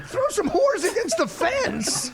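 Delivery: slow, high, laughing disbelief; incredulous repeat. Real, not acted. Host repeating the guest's line, laughing incredulously. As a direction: echo it back, cracking up in disbelief.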